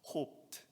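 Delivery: "Hoped" breathed out as a soft whisper. Shown as a demonstration. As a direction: set apart the word "Hoped" in a hush. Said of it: "use of the word hope".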